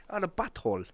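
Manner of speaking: imitated Spanish accent on "butthole".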